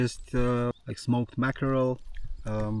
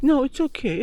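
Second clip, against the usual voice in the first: high, loud quick reassurance; calm her fast, pitched up; soothing a scared dog; 12 semitones higher, 6 dB louder than usual. Real, not acted. Dog startles.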